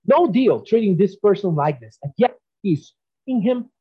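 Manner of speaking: usual speaking voice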